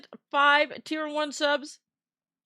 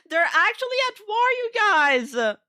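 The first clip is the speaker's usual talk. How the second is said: excited; 8 semitones higher, 5 dB louder than usual. Real, not acted. Gift war between chats.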